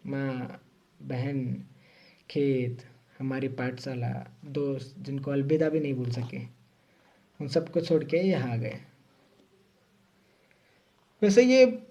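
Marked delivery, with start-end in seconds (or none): 0.1-8.7 soft, low-key list of what was left behind; name each one quietly, with pauses; wistful. Real, not acted. Recalling all he left behind. (उदास, धीमी आवाज़ में)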